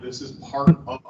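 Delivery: ordinary delivery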